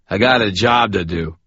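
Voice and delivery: lightly voiced drunk, a touch of offence; a hint of a tipsy man taking offence; mildly indignant drunk. A narrator's reading. Drunk Chuck scowling.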